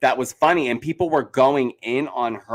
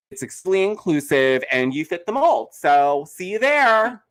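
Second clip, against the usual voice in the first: condescending; 5 semitones higher than usual. Retold or imitated. Podcaster retells how the invitation felt, exaggerated condescension. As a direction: imitate a patronizing invitation, slowing and lifting the ending. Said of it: "it was condescending".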